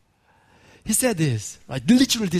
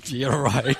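dry, lowered, disbelieving aside; sarcastic inner voice; 6 semitones lower, 3 dB quieter than usual. Retold or imitated. Thinking to himself at dinner, dry sarcastic disbelief. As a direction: say it like you don't believe a word. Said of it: "deep inside my heart, I was like".

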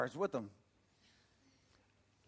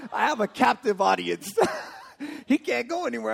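raised voice breaking into laughter; laughing delight; 5 semitones higher, 6 dB louder than usual. Real, not acted. Preacher joking about his captive audience, laughing delight. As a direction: crow it gleefully through laughs.

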